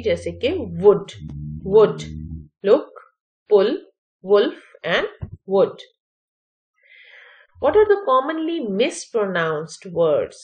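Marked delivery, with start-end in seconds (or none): 1.6-5.8 "वुड, लुक, पुल" louder, each with short oo; word list demo. Shown as a demonstration. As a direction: list the words clearly. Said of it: "ओ यू से भी इसकी pronunciation". (शब्द एक-एक करके साफ़)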